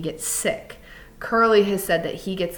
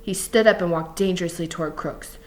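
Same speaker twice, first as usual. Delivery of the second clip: ordinary delivery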